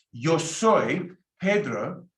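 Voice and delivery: slow and clearly spaced, each word laid out; slow teaching demo. Shown as a demonstration. Teacher modelling the phrase for students, slow and clear. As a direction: model it slowly for learners. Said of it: "teacher models Spanish phrase".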